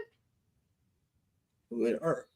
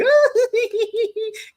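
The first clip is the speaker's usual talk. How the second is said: put-on childish giggle; 12 semitones higher than usual. Little girl laughs in retelling.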